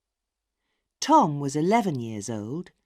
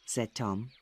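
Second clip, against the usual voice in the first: ordinary delivery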